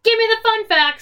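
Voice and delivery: loud, high call for the segment; big, high-pitched hype; excited shout. Pretend. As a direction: shout it out like a game-show cue. Calls out the next segment.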